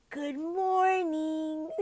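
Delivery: high, very drawn-out greeting; cheery child greeting. Shown as a demonstration. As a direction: sing it out slowly to a child. Acted greeting to waking panda.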